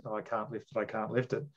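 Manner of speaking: quiet, feeble protest; half-hearted giving up. Acted. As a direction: say it softly, like you've given up already. Acts out someone giving up easily.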